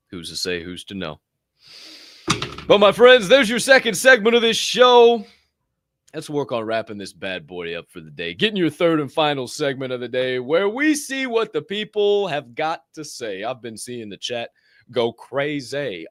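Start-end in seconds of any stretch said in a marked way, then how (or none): 2.7-5.2 high, loud segment sign-off; show announcer. Real, not acted. 8.3-13.4 rising, building segment introduction; show announcer. Real, not acted.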